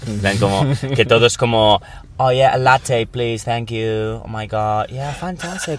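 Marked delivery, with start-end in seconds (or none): 2.2-5.8 a lightly voiced celebrity diva; mocking a diva. Mocking the fake famous diva, a light gushing voice. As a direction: gush like a posh celebrity ordering a latte. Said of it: "la típica persona así, diva".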